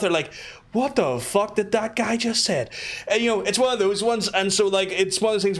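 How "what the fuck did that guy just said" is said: slower, higher mock disbelief; baffled American. American client not understanding the accent, mock bafflement. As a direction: imitate a puzzled American, drawn out. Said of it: "people from America and stuff".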